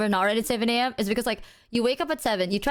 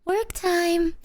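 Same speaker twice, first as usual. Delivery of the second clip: high, bouncy work time call; chirpy announcement; 7 semitones higher than usual. Retold or imitated. Boyfriend imitating girlfriend's cheery call to start work, high and bouncy.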